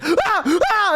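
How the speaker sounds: two high startled yelps; mock cry of a man jumping back; startled scream imitation. Mailman startled on the porch, imitated high yelps. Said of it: "he, like, almost, like, fell off".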